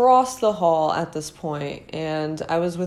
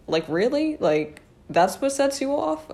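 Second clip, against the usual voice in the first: incredulous; 8 semitones higher than usual. Retold or imitated. Incredulous retort to bully.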